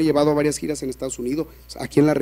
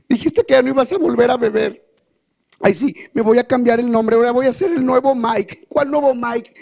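esposa burlándose con voz aguda y fuerte; imítalo con sarcasmo agudo; burla enojada; 7 semitones higher, 3 dB louder than usual. Acted. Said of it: "estaría de enojada". (esposa imitando burlona al marido, aguda y fuerte)

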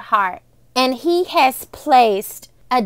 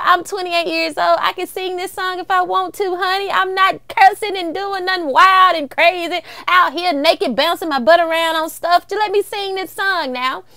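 exasperated; 8 semitones higher than usual. Retold or imitated. Retold self, arguing with mom.

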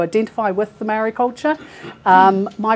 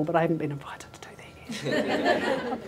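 whispered aside; 3 semitones lower, 10 dB quieter than usual. Real, not acted. Joking aside about not being invited.

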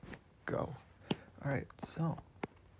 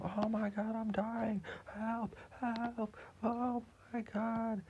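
high, stretched cries for help; villain calling for help as he explodes; dying villain cries; 11 semitones higher, slower than usual. Acted. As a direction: squeal like a cartoon villain dying.

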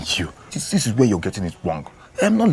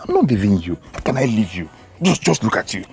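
ordinary delivery

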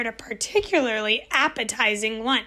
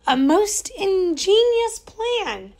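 pleased husband; 7 semitones higher, slower than usual. A narrator's reading. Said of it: "agreed Mister Grinling".

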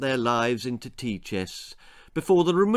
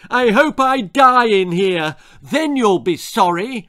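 high, loud sulking wail; shouted tantrum through a door; sulky shout; 9 semitones higher, 10 dB louder than usual. A narrator's reading. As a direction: yell it like a furious sulking kid. Child sulking threat from bedroom.

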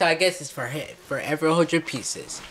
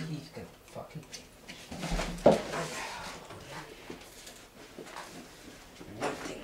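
muttering to self; 3 semitones lower, 16 dB quieter than usual. Real, not acted. Muttering while searching.

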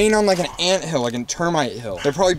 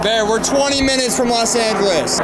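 raised, high-pitched exasperation; exasperated yell; 7 semitones higher, 4 dB louder than usual. Acted. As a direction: shout sense into a hysterical man. Exasperated cameraman corrects shouting man.

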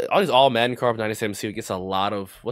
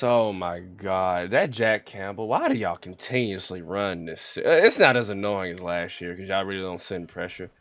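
normal talking voice

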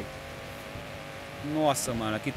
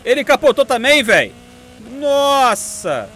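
excited shout; 9 semitones higher, 8 dB louder, slower than usual. Real, not acted. Rival car flips over.

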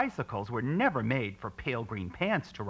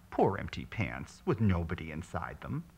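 tender; 6 semitones lower, 3 dB quieter than usual. A narrator's reading. Narrator softly comforting the poor empty pants. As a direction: soothe them gently.

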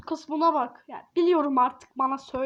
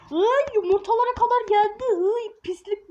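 disgusted; 6 semitones higher, 4 dB louder than usual. Real, not acted. Baby makes a mess.

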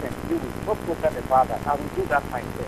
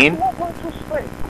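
much higher, heated retort; shrill indignant yell; 9 semitones higher, 4 dB louder than usual. Real, not acted. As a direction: fire the question back at him. Caller repeats his angry question.

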